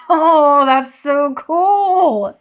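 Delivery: loud, drawn-out delighted exclamation; thrilled. Real, not acted. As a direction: laugh into a big, stretched-out gush. Opening the book, overjoyed.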